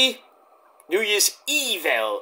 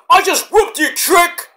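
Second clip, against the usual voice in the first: high, loud taunt at the camera; gloating yell; 11 semitones higher, 5 dB louder, slower than usual. Retold or imitated. Wrestler taunting his opponent into the camera, loud gloating yell. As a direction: crow it like a wrestler who just won. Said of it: "Waller goes to the camera and is like".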